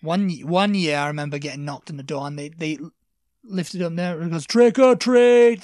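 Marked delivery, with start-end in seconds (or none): 4.5-5.6 high, loud and slow through the letterbox; drawn-out trick or treat. Retold or imitated. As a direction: call it slowly through the letterbox. Kids calling through letterbox.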